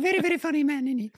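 very high, squeaky impression of an Italian climber; Simone Moro. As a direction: imitate him in a piercing, high cartoonish voice. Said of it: "Simone has this voice that is just epic".

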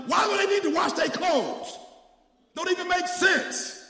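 loud, high shouted preaching; preaching at full force. Acted. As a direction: whip the church up, full voice.

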